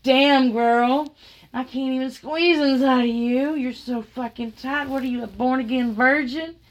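imitated incredulous outburst; astonished exclamation. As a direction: quote them exclaiming in amazement. Voices the partner's astonished remark.

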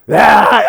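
loud mock yell; wordless yell in place of an answer; imitated scream. Suspect answering the accusation, loud imitated scream.

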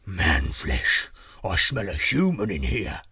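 deep giant voice; slow-witted menace from a deep-voiced giant; suspicious giant. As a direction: growl it low as a suspicious monster.

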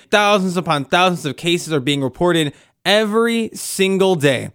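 ordinary delivery

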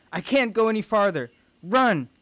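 high, loud desperate plea; desperate cry. Acted. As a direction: shout it urgently to send him away. Exhausted mother begs son to run.

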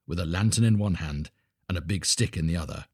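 usual speaking voice

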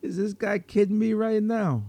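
high, loud disbelieving outburst; is he kidding me, pitched high and loud; incredulous. Acted. Vampire incredulous at victim.

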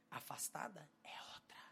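low, soft whisper; hushed punchline. Real, not acted. Preacher contrasts praying for estranged mother, hushed. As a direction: fall away to a near whisper.

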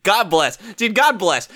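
ordinary delivery

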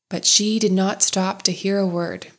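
ordinary delivery, nothing marked

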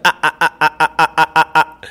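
mock rapid-fire laugh, lightly done; machine gun laugh imitation. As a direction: rattle off a staccato ah-ah laugh. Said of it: "it was a machine gun".

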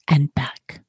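soft smiling whisper. Acted. Big hare whispering goodnight to the sleepy little one, smiling whisper. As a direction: breathe it like a goodnight secret. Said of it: "whispered with a smile".

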